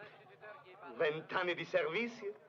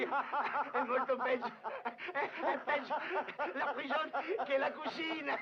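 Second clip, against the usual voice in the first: laughing retort; 7 semitones higher than usual. Acted. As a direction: insist while laughing. Brigadiere ride ribattendo. (protesta ridendo)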